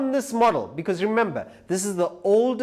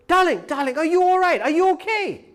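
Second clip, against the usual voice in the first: high, louder, anxious calling out to his fallen wife; a frantic husband fussing over his wife; worried husband; 10 semitones higher, 4 dB louder than usual. Retold or imitated. To his wife after her fall, a high, anxious worried husband. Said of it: "the husband was saying".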